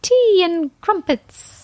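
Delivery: slow, high prim English voice; hammy English lady; posh British accent. Pretend. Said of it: "I wanted to do something like British lady".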